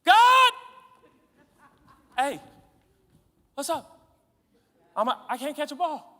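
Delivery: struggling player loudly demanding God bless his plan; desperate plea. Retold or imitated. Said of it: "You're like".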